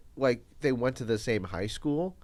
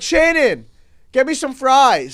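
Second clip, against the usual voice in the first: imitating a rowdy teen; 10 semitones higher, 11 dB louder than usual. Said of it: "They're like".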